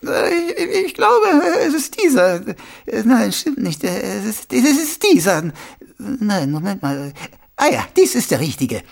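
put-on high, hesitant little old woman; dithering old lady. Old shopkeeper hunting for the right key, dithering.

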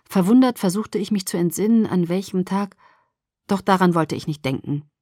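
usual speaking voice

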